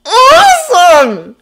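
loud, high, drawn-out awe; loud, sighing amazement; blown away. A narrator's reading. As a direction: stretch it out, completely awed. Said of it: "Ned practically sighs".

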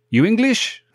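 high, eager seller's call; street seller's question. Retold or imitated. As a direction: pitch it up like a hawker. Street sellers asking Hugh.

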